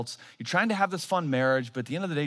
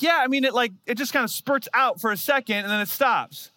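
imitating wife; 8 semitones higher, 3 dB louder than usual. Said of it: "She's like".

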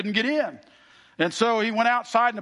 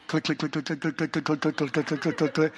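soft, low click click clicks of the phone; imitated clicks; 6 semitones lower, 4 dB quieter than usual. Brother clicking the line to annoy the neighbors, soft mimicked clicks. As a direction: tap out the clicks quietly, low in the throat.

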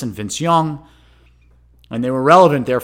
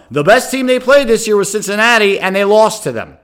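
louder, higher exasperated rant; worked-up rant; 6 semitones higher, 6 dB louder than usual. Real, not acted. Host ranting at listeners about Notre Dame, exasperated and loud. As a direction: hammer home the point, fed up.

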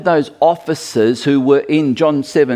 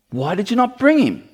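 lightly acted high-pitched reproach; a touch of exasperated authority; indignant chief priests; 8 semitones higher than usual. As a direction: demand an explanation in a higher, mock-indignant voice. Acting out priests scolding the officers.

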